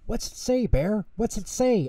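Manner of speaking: high-pitched, impatient little questions; eager asking. A narrator's reading. Tutter asks Bear about the letter, high and eager.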